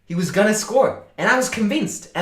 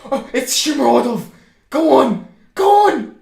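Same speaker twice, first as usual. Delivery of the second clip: real screaming joy, high and loud, laughing through it; goal celebration; 11 semitones higher, 6 dB louder than usual. Real, not acted. Streamer losing it as his tipped striker scores, ecstatic yelling. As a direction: erupt as the goal goes in.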